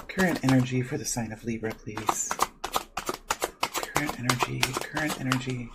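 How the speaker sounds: soft repetitive chant under the breath; murmured invocation. Real, not acted. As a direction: quietly chant while shuffling cards. Murmured card-pulling invocation while shuffling.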